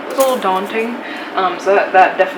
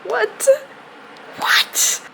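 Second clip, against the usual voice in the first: high, incredulous what; giddy disbelief. Real, not acted. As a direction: ask in stunned delight. Disbelief at reaching goal.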